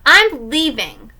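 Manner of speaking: slow, loud, defiant announcement; announcing. Acted. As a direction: announce it grandly, drawn out. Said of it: "I announced".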